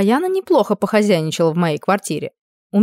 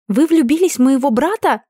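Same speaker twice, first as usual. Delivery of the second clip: excited teasing; 6 semitones higher than usual. Acted. Giggling excited question.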